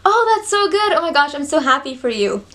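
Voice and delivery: gushing, high-pitched acted listener; hammed-up delight for someone; other person. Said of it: "I'm the other person".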